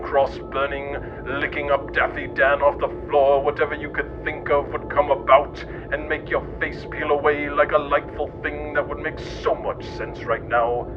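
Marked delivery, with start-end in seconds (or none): none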